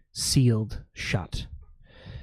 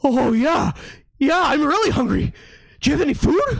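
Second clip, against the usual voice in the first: eager dog voice; 4 dB louder, faster than usual. Acted.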